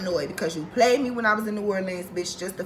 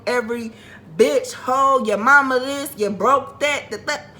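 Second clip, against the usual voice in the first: mock name-calling; 4 semitones higher, 4 dB louder than usual. Retells calling him names.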